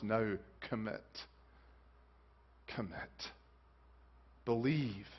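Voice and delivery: slow, low, earnest urging; hushed appeal. Acted. Jesus calling Nicodemus to commit, hushed appeal. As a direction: drop low and let each word land softly.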